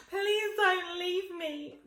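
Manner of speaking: high, loud, slow pleading wail; desperate plea. Retold or imitated. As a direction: beg him not to go, drawn out and high. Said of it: "That's the fucking reason that I was like".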